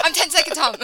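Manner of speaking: giggling self-intro; laughing. Retold or imitated. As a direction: laugh through the name.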